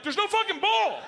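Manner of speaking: high, loud disbelieving shout; exasperated yell. Retold or imitated. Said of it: "I'm like".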